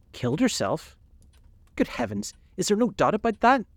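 high-pitched, shocked exclamation; aghast, disbelieving questions rising high; horrified cry. A narrator's reading. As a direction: cry out in horror, pitch leaping up. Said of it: "cried Hallward, looking up at him with an expression of horror".

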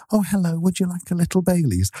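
a hint of a sweet lady offering drinks; lovely hostess voice. Friend mimicking Leanne's sweet mum offering Baileys, lightly sweet. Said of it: "she comes across such a lovely".

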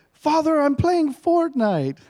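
imitating his son's prim British reply; British accent. Son explaining to his dad, in a mock British accent. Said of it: "if you do it in a British accent".

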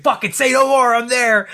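loud, high, gung-ho shout; eager acceptance. Retold or imitated. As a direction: imitate an all-in, excited kid. Acts out kid eagerly accepting invitation.

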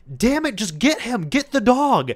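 a frustrated father shouting at his son; dad yelling. Retold or imitated. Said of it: "and he yells at his son".

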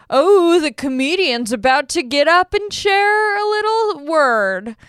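mock people. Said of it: "people are like".

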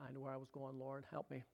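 a hushed plea under the breath; quiet aside to God. Real, not acted. Pastor lost his place, quietly asking the Lord for help.

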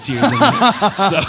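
chuckling through the line; laughing. Real, not acted. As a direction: laugh while saying it. Joke about crying, laughing.

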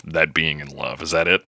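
normal talking voice

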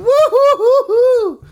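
loud, high, slow woo-hoo; mock whooping. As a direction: whoop in sarcastic celebration. Sarcastic whooping.

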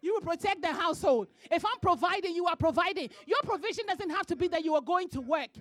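loud, high preacher's delivery; loud sermon cadence, hammering each line; full-force preaching. Acted. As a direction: preach it hard to the congregation.

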